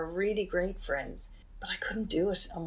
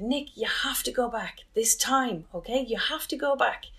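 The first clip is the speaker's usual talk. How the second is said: raised-pitch, emphatic retold insistence; insistent urging; 6 semitones higher, 3 dB louder than usual. Brother urging his crying sister to listen, insistent. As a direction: press the point like a concerned brother.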